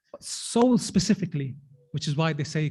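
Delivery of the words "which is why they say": ordinary delivery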